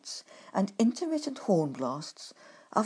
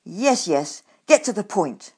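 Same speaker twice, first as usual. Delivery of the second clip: louder, impatient brush-off; curt; 8 dB louder than usual. Acted. Grumpkin hurries the dithering badger, curt impatient retort. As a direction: cut him short, snappish. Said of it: "I said curtly".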